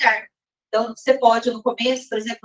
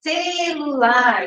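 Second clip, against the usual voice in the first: "celular" stretched out slowly, syllable by syllable: ce-lu-lar; spoken slowly; 4 semitones higher, much slower than usual. Shown as a demonstration. Teacher dictating "celular" slowly, syllable by syllable, for pupils. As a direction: give each syllable of "celular" its own slow beat. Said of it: "daí você vai falar mais pausadamente".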